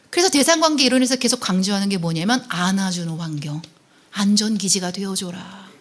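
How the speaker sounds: normal talking voice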